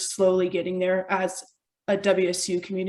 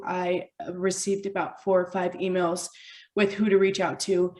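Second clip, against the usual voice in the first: usual speaking voice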